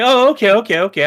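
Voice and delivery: high, loud burst of sudden agreement; eager acceptance. Acted. Acting out his reaction to the British version, eagerly convinced. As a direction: pipe up as if instantly won over.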